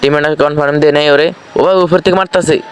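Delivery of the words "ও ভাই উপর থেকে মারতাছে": sudden alarmed shout, pitched up; alarmed high call. Real, not acted. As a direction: yelp that they're shooting from above. Shot at from above, alarmed. (উঁচু গলায় আতঙ্কিত চিৎকার)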